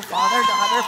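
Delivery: "father, daughter" called out high and loud. Retold or imitated. Walking into the building, calling out to God in a high loud voice. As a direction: sing it out loud as you stride. Chanting as she walks in.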